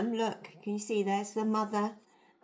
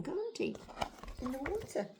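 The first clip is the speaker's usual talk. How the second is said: usual speaking voice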